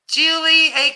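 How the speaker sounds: ordinary talk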